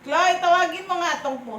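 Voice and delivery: loud, high call across the house. Real, not acted. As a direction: shout for someone to come help. Calling out to Chloe for help.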